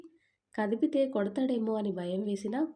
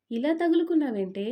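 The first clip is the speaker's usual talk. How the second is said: louder and higher, fed-up brotherly retort; exasperated; 5 semitones higher, 7 dB louder than usual. Acted. Brother fed up with his sulking sister, louder exasperated retort. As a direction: throw up your hands at her.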